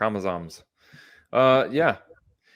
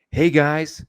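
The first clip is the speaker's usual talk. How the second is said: higher, louder mock announcement; imitated announcer of doom; 6 semitones higher, 6 dB louder than usual. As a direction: announce a threat like a casual group greeting. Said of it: "He's gonna be like".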